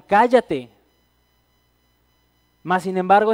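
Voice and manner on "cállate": "Cállate" barked out louder as a mock crowd yell; shouted hush. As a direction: snap it at him like an angry crowd. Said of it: "le gritaron".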